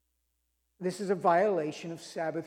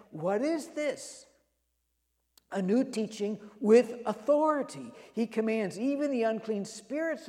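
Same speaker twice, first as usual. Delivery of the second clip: amazed crowd; 4 semitones higher than usual. Acted. Amazed crowd questioning each other.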